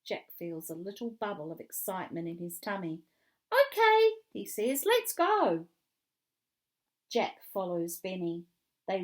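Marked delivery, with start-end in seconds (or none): 3.5-4.1 loud, high, eager reply; thrilled playground shout; excited agreement. A narrator's reading. Jack eagerly joins the game. 4.9-5.7 high, loud rallying call; thrilled playground cry; excited urging. A narrator's reading. Jack eagerly joins the game.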